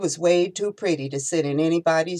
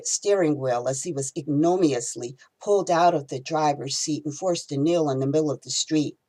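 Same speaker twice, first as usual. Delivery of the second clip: usual speaking voice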